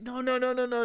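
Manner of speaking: high, loud, rapid string of refusals; urgent protest. Acted. Answering her as she starts crying, urgent protest. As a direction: rush in to stop the tears. Hurried reply to a crying friend.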